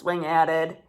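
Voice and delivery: plain reading voice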